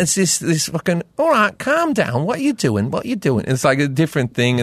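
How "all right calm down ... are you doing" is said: higher-pitched Liverpool accent; chippy modern Scouse. Shown as a demonstration. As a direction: do a touchy present-day Liverpudlian. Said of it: "now it's just a bit more chippy".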